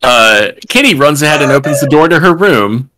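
ordinary delivery

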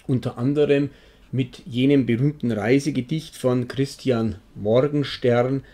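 usual speaking voice

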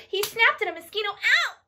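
high, quick burst into a yelp; playful snapping. Acted. Telling children the turtle snapped at a mosquito, playful snapping. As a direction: build fast to a high "Ow". Turtle snaps in action rhyme.

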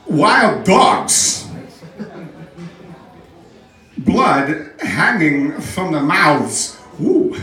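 creepy, halting celebrity impression; lurching pitch with odd stretched phrasing and a high whoop; Christopher Walken. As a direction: punch odd words, leave long eerie pauses. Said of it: "would be Christopher Walken".

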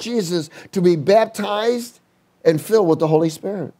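fervent sermon delivery, rising pitch; preaching. Acted. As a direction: preach it with conviction. Preaching from the pulpit.

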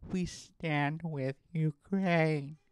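slow, drawn-out mocking earnestness; mock earnest slogan. Mocking Instagram posters, slow and earnest. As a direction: parody a solemn post. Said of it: "saying things like".

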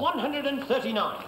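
darts score caller. Real, not acted.

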